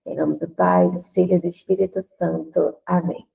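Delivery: ordinary delivery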